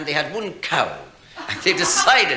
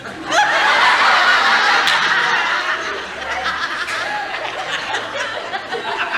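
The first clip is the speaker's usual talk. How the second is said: long high-pitched laugh; 4 dB louder than usual. Real, not acted. Comedian laughing at his own joke, long and high.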